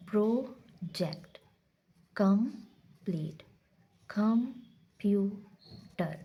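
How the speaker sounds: प्रोजेक्ट, कंप्लीट, कंप्यूटर said very slowly, syllable by syllable; slow syllable-by-syllable demo; syllable breakdown. Shown as a demonstration. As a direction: chop each word into syllables for the class. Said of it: "ये देखें".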